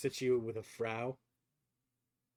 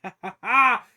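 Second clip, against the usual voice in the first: loud, high belly laugh in character; hearty acted laugh; 8 semitones higher, 11 dB louder than usual. Dwarven stranger laughing his ass off at the party, hearty laughter. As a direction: burst out laughing as the amused stranger. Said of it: "laughing his ass off".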